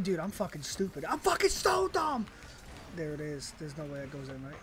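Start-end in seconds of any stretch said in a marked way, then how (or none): 1.0-2.3 high, strained self-reproach; exasperated outburst. Real, not acted. Gamer berating self as stupid, exasperated. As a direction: berate yourself in a raised pitch.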